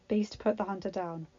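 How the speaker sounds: plain reading voice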